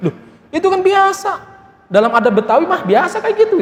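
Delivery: the husband reassuring his wife, in a high comic voice; high mock husband.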